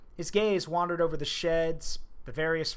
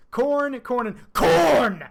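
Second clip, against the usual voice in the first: corn shouted, higher, stretched out slow; all-caps corn; 7 semitones higher, 8 dB louder, much slower than usual. Shown as a demonstration. Reader shouting the all-caps word corn to chat. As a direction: yell each corn like it's in capitals. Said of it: "So capital K H O R N E".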